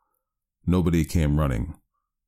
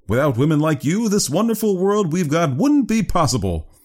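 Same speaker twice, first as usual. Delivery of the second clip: high, lively flattering voice; effusive character voice; 12 semitones higher, 4 dB louder than usual. Acted. Praising women for the wonderful world, effusive high voice. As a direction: gush with a high, lifted voice.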